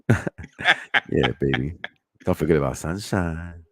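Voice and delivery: very deep, hammed-up seducer voice; Chris Pratt. Host imitating a man wooing with movie lines, deep seduction. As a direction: drag it out low like a smooth pickup line. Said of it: "let me try to sound like Chris Pratt".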